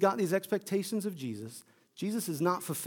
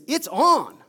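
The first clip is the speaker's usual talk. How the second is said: Peter thrilled; 3 semitones higher, 8 dB louder, slower than usual. Acted. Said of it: "And Peter thinks".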